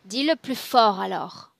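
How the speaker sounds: loud, pressing demand; forceful command. Real, not acted. Practitioner pushing a client to speak up, forceful command. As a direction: raise the voice and urge them on.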